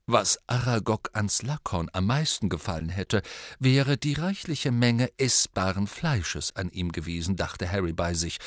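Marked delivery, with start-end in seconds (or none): none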